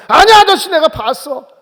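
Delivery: high, loud acted girl's cry; insistent accusing girl. Girl chases Peter to say she saw him, high insistent shout. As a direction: pipe up loudly and insist as the girl.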